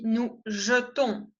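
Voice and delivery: jetons with a light, closed e; slow nous jetons; closed e demo. Shown as a demonstration. Teacher modelling a different E sound. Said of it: "es otro sonido".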